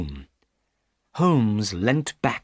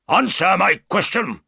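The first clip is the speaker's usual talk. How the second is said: higher, louder furious bark; angry shout; 10 semitones higher, 6 dB louder than usual. Acted. Roylott shouts at Holmes to answer, angry shout. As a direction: make him obey you, now. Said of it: "shouted Doctor Roylott angrily".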